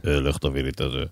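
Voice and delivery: a deep-voiced dad barking an errand; mock threatening father. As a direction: play the big bass father ordering the kid. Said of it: "איך אתה צועק עליו?".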